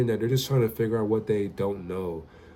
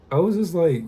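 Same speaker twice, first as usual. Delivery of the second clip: ordinary delivery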